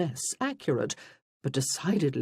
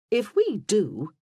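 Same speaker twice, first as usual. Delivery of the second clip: slow, higher, lightly voiced; mock-haughty sister; 5 semitones higher, 5 dB louder, slower than usual. A narrator's reading. Freya teases her sister-in-law, mock-haughty. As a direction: draw it out as a warning.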